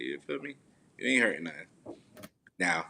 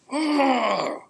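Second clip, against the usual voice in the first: startled gasp; 7 dB louder than usual. Real, not acted. Startled by child appearing.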